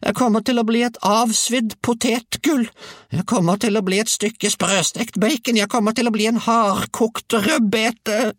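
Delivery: sustained high-pitched wail; bawling old woman. A narrator's reading. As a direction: wail it out as a frantic grandmother, very high. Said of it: "vrælte hun".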